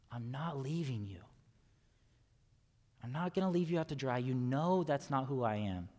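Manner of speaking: calm them gently, it will be fine; reassuring Jesus. Acted. Pastor voicing Jesus reassuring disciples.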